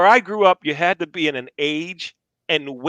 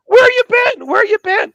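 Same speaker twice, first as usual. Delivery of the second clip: much higher and louder, eager greeting; excited coaches; 6 dB louder than usual. Retold or imitated. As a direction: greet him like a long-lost star player. Coaches greeting the big recruit.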